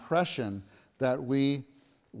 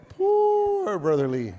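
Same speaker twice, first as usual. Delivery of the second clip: much higher, slow, sighing put-on voice; imitating Brother Lee; 6 dB louder, slower than usual. Preacher mimicking his teacher's complaint to the saints, high and pitying. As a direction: draw it out high and pitying, a fond caricature. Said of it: "That's how he would say".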